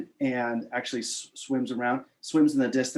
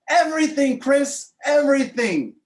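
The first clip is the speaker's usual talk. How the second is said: high, loud, insistent cry; emphatic exclamation; 12 semitones higher, 7 dB louder than usual. Retold or imitated. Mentor answers Chris's doubt about business success, emphatic. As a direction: drive each word home at high pitch.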